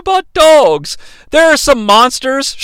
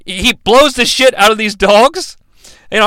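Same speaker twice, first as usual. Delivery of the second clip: ordinary talk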